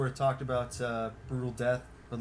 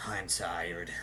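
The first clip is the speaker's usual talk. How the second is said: low, lower-pitched and quieter deadpan; bored grumble; 6 semitones lower, 4 dB quieter than usual. Retold or imitated. Scott brushing off a fan, low bored voice. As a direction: sigh it out like you can't be bothered.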